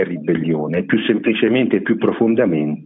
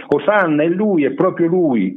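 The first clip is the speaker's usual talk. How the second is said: higher-pitched, lightly voiced exclamation; crowd acclamation; 7 semitones higher than usual. A narrator's reading. People recognise Jesus and hail him, exclaiming.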